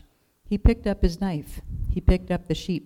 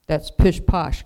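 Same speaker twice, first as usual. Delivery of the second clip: gruff growl; 7 dB louder, slower than usual. A narrator's reading. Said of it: "growled the woodcarver".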